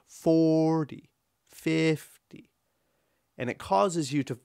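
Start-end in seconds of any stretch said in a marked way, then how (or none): 0.1-2.5 "Forty", "fifty" with a long stressed first syllable and tiny "-ty"; exaggerated FOOOR-ty, FIIIF-ty; strong-weak syllables. Shown as a demonstration. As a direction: stretch FOR and FIF, drop the "-ty". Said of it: "second syllable is unstressed".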